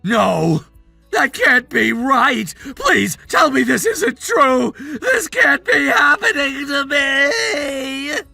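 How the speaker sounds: drawn-out, despairing wail; crying like a baby. Acted. Son hearing his dad is disappointed, crying like a baby. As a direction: plead tearfully, stretching the last words out. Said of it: "quit crying like a baby".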